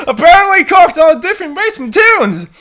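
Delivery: high, loud, laughing through it; laughing aside. Real, not acted. Reader laughing at the racism joke, high and loud. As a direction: squeal it while laughing.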